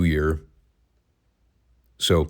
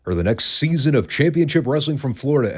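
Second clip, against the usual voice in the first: higher, salesy TV executive pitch; cheesy TV exec; 7 semitones higher than usual. Retold or imitated. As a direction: ham it up like an eager exec. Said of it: "came up to them and said".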